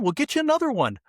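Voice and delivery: high, flustered version of the soothing line; rushed and panicky, hammed up; mock frantic adult. Adult panics over his own wallet, high and flustered.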